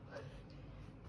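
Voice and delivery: short breathy laugh at a slip; embarrassed laugh. Real, not acted. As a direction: laugh at your own mistake. Flubs the line, laughs.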